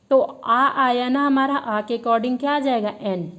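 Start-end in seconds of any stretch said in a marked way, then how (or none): none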